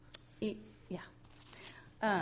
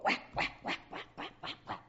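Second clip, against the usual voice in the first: imitated hammering; 6 dB quieter than usual.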